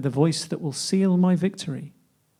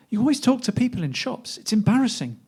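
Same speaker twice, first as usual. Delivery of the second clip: higher-pitched mild complaint; lightly acted reproach, pitched up; embarrassed complaint; 7 semitones higher than usual. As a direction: complain as if mildly mortified. Acted quarrel in poem.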